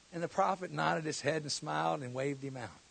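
usual speaking voice

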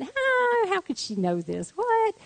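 high gasp, dropping, then leaping up again; hammed-up bewildered shock; stunned disbelief. Thinking how could she know this, stunned disbelief. Acts out her stunned thought.

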